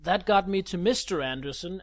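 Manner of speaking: normal talking voice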